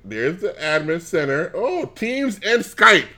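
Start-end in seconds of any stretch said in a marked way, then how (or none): 1.5-3.1 drawn-out, high-pitched mock wonder; mock delight. Finding Teams and Skype in the admin center, mock delight. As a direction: savor a pretend discovery, slowly and high.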